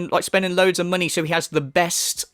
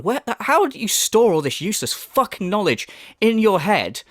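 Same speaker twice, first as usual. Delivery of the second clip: usual speaking voice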